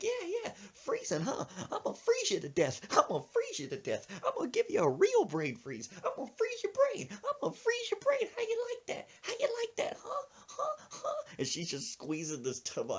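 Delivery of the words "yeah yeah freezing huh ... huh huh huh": high-pitched taunting rant; mock old lady on the attack, building higher; vengeful old woman. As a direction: climb into a frantic falsetto gloat. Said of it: "She's like".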